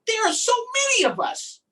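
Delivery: high, loud excited call; excited cry. Acted. Adri telling his parents about the fish, high excited cry. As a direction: cry it out, thrilled. Excited character line in book.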